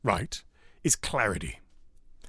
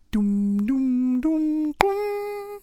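airport chime; much slower than usual. Retold or imitated. Airport chime before announcement.

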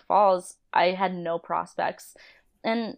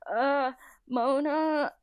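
a shy, hesitant high voice, drawn out slowly; 7 semitones higher, much slower than usual. Retold or imitated. Timidly calling the boss before quitting, shy and slow. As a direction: nervously get the boss's attention. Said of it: "like, so shy".

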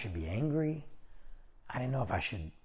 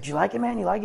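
quick, higher-pitched imitation of a chatty star; imitated fast talk; 8 semitones higher, 4 dB louder, much faster than usual. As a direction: fire the questions off, pitched up. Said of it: "He's talking real fast".

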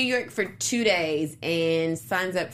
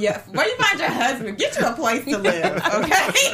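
laughing; 3 semitones higher, 4 dB louder than usual. Real, not acted. Laughing advice to the woman.

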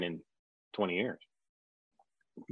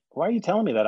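high, louder exasperation; exasperated; 9 semitones higher, 5 dB louder, faster than usual. Retold or imitated. John protesting to the producers, high exasperated. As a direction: protest in disbelief.

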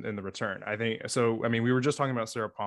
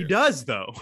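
laughing; 6 semitones higher, 6 dB louder, slower than usual. Real, not acted. Insists while laughing.

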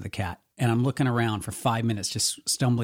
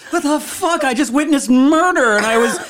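Amy; 8 dB louder than usual. Retold or imitated. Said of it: "Amy's running up like".

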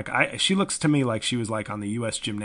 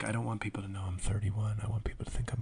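mock whispered actress; 2 semitones lower, 9 dB quieter, faster than usual. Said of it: "that'd be great if she's like".

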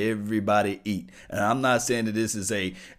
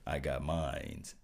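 soft aside; 7 semitones lower, 9 dB quieter, slower than usual. Real, not acted. Coffee joke aside.

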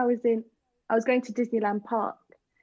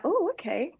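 higher-pitched mock-flattered reply; lapping it up; 6 semitones higher than usual. Tourist told her accent is nice, lapping it up. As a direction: play coyly pleased at a compliment. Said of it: "you lap it up".

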